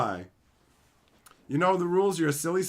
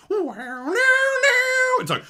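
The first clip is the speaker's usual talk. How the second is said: high, loud hum of a sci-fi theme tune; imitating spooky intro music; 7 dB louder than usual. Streamer mimics the Doctor Who intro, high and loud. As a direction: sing the scary theme note. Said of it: "intro music".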